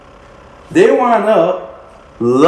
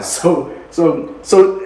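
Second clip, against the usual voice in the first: usual speaking voice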